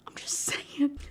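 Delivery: soft breathy whisper, half to herself; whispered aside. Real, not acted. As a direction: whisper it like a guilty joke. Whispered aside after joke.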